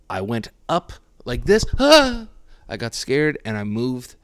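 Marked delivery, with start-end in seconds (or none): 1.8-2.3 startled yelp. Retold or imitated. Reenacting getting scared shaving.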